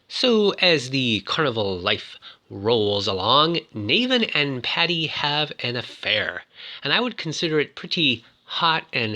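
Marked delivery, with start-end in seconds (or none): none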